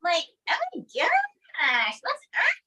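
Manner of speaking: put-on high, drawn-out valley girl voice. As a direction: gush like a ditzy valley girl. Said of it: "Mae Wilson".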